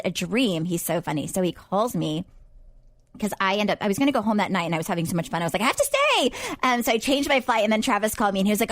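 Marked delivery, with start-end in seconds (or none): 5.6-6.3 high, eager party plea; mock-excited high declaration; giddy insistence. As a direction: beg yourself to stay longer. Deciding to skip flight home.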